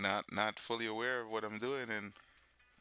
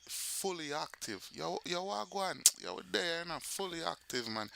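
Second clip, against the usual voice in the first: put-on higher-pitched Jamaican accent; Jamaican patois greeting; 5 semitones higher than usual. Showing what you often hear in Jamaica, Jamaican accent. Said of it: "how often do you hear that".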